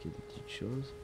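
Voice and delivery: normal talking voice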